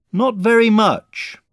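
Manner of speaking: high, slow, loud reply; calling up. A narrator's reading. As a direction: call it up deliberately. Boy calls up to floating bear.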